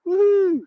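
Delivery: high-pitched "woohoo"; cheery whoop. Real, not acted. Cheery whoop greeting arrivals.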